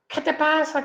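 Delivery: mock a mother's startled challenge; scolding mother. Mother confronting son over the soaked cigarettes, scolding.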